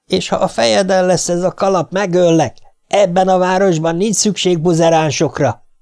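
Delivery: high-pitched angry threat, mildly yelled; restrained yell. A narrator's reading. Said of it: "üvöltötte Gerton a távolodó pár után". (visszafogottan üvöltött, magas hangú fenyegetés)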